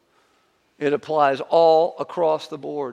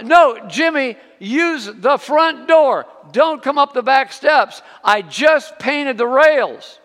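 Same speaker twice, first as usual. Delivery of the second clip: high, loud warning yell across the yard; 11 semitones higher, 10 dB louder, slower than usual. Retold or imitated. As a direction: shout a warning to the boy. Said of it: "And she yells out to him".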